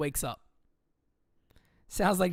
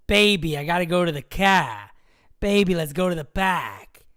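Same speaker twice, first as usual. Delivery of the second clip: mock Boston accent, louder; a hint of Boston, pahk not park; 8 dB louder than usual. Imagining his fiancée as a Red Sox fan, mock Boston accent. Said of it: "that Red Sox accent".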